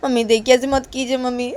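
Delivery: loud, high anxious begging; pleading daughter. Acted. Daughter backing away from angry mother, loud anxious pleading. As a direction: beg mum to stop, rising. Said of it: "बोलती".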